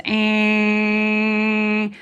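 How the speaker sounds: a flat, held nasal drone of a stuck car horn; imitated car horn. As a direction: hold one long steady note like a blaring horn. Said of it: "all I hear is".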